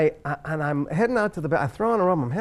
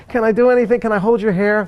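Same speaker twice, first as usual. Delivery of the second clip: higher-pitched, earnest roommate offering help; imitated helpful voice, pitched up; eager helper; 6 semitones higher, 4 dB louder than usual. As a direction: mimic a dutiful friend piping up to help. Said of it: "come in like a good soldier".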